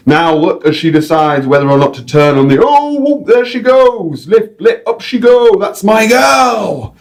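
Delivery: high, loud Northern English commentary building to a shout; excited Northern English accent. Acted. Guide urging the eagle up, loud Northern English accent. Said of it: "random North English accent".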